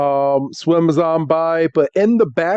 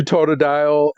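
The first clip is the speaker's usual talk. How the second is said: normal talking voice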